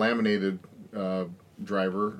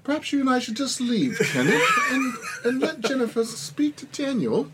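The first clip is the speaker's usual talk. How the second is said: put-on much higher, laughing English accent. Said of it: "my poor English accent".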